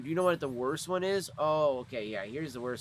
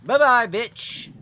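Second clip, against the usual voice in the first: taunting; 7 semitones higher, 10 dB louder, much slower than usual. Real, not acted. Taunting a killed enemy.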